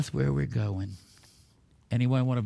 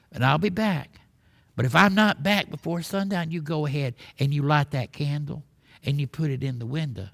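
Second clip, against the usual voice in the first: folksy Texas twang, punchy tall-tale telling; broad mock-Texan storyteller; put-on Texas cowboy accent. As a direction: spin it like a Texas cowboy yarn. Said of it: "put on my best strange accent".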